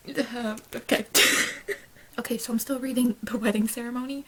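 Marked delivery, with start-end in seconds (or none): 2.2-4.2 hushed breathless whisper. Real, not acted. Updating viewers mid-reading, whispered. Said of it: "I'm, like, in frantic mode".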